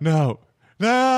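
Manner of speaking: high mock-horrified cry; horrified denial. Retelling the man finding the mural empty, horrified. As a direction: draw back in disbelief.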